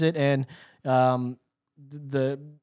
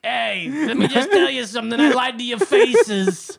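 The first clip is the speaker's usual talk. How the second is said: mock New York accent; 7 semitones higher, 5 dB louder than usual. Fauci spoofed in New York accent, smugly admitting lies. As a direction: gloat like a New York wise guy. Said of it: "you gotta have the New York accent".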